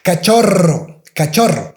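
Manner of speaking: "Cachorro" with the rr trilled, ca-CHO-rro; strong trilled R. Shown as a demonstration. Teacher modeling the double R word. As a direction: roll the rr in Cachorro. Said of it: "you have to pronounce the strong R".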